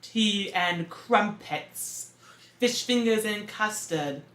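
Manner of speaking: mock British accent. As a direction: drag out each word in a jokey British accent. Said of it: "How to speak with a British accent".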